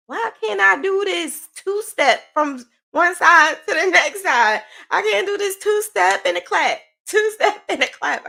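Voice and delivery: high, loud mock-frustrated rant; incredulous wail with a laugh breaking in; exasperated disbelief. Acted frustrated inner voice about exercise.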